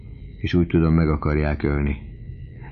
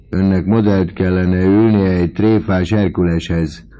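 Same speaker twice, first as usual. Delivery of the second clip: ordinary delivery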